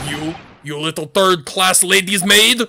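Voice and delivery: put-on loud gruff Russian-accented sailor bellowing at a stowaway; Russian sailor shout. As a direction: bark it like an angry Russian deckhand.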